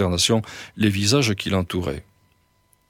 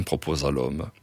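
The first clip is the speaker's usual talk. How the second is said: usual speaking voice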